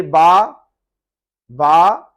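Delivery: बा loud, open "aa" with no R; silent R. Shown as a demonstration. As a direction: say बा for "bar", dropping the R. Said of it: "आर pronounce नहीं करनी". (बा, आर के बिना ज़ोर से)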